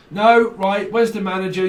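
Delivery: demanding customer. Acted. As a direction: insist on the manager, fed up.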